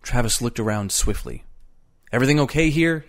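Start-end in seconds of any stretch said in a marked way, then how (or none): 2.1-3.0 tense, higher-pitched question; snapped. A narrator's reading. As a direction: fire the question off sharply. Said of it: "he snapped".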